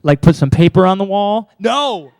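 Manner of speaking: loud scoffing student voice; mocking skeptic. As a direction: scoff like a doubtful teen. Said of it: "a bunch of you are like".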